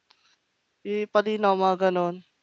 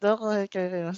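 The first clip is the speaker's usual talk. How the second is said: ordinary talk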